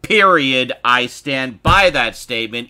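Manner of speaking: loud, slow passionate praise; emphatic. Real, not acted. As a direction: declare it loud and slow like a fan.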